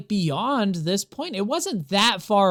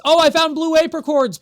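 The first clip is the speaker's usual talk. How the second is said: much higher, loud burst of delight; excited; 12 semitones higher, 9 dB louder than usual. Real, not acted. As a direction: yelp with joy at the find. Sudden delighted find in game.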